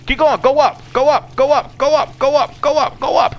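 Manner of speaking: high, rapid-fire repeated command; urgent urging. Real, not acted. Gamer urging the character to climb, urgent high chant.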